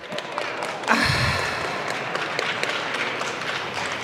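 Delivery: a long, breathy, fed-up exhale; an audience member sighs in frustration at the politician; long exasperated sigh. Real, not acted. Heckler exasperated at the answers.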